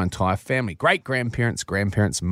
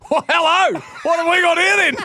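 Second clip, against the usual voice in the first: put-on high, loud cheeky old man yell; excited old man; 4 dB louder than usual. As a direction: shout it like a delighted old granddad.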